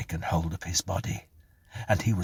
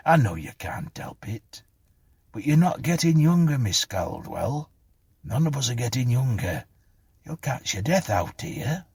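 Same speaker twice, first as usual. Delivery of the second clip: higher, emphatic kindly bobby; concerned policeman; 6 semitones higher than usual. A narrator's reading. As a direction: admonish her like a caring policeman. Voices the policeman.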